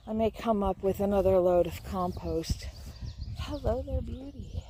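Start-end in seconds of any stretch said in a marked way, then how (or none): 3.4-4.6 soft, tender hello to a plant. Real, not acted. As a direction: coo gently at the little plant. Greeting a plant in the garden.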